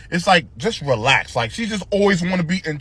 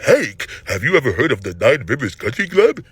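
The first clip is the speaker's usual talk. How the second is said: lower, talking like a mouth full of candy and a blocked nose; stuffed-up nose voice; 5 semitones lower than usual. Retold or imitated. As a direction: do the stuffy, uppity neighbor. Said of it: "the way he talks irks my fucking nerves".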